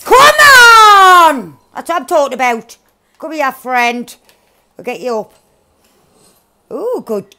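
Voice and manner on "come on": loud drawn-out cheer; victory shout. Real, not acted. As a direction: roar it in triumph.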